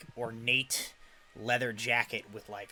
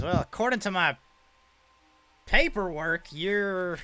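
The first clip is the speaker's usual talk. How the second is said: higher, louder showman voice; showman reading papers; 7 semitones higher, 6 dB louder than usual. Acted. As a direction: read it off his papers with swagger. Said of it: "He says".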